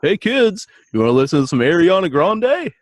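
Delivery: mock a cheesy stepdad chasing the kids' approval; stepdad Gary. Mocking a friend's stepdad greeting the kids, high and loud. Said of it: "it's my stepdad, Gary".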